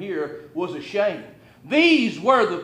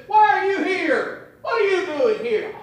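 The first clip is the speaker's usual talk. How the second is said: put-on angry older brother; 8 semitones higher than usual. Older brother Eliab rebuking David, high and outraged. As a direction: shout it down at the little brother. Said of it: "He turns around to him and says".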